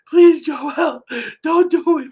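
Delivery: high, mock crying plea; Red. Co-host mimicking crying friend begging Joel, mock tearful pleading. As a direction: beg in a hammed-up tearful squeal. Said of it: "Red, you were crying".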